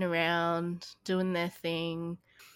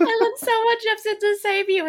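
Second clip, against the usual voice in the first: excited; 14 semitones higher, 9 dB louder than usual. Real, not acted. Excited telling of rescue scene.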